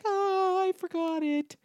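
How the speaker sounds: high, airy mock of a ditzy friend; mocking airhead voice. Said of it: "your dumb ass was like".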